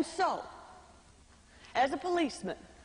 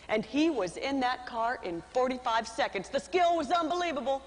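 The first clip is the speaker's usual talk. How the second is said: usual speaking voice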